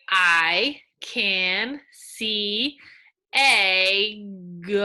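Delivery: drawn-out, word-by-word reading; slow reading aloud. Shown as a demonstration. Teacher reading slowly to young students. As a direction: sound it out slowly for the kids. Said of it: "we're gonna read it".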